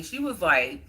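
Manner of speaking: ordinary talk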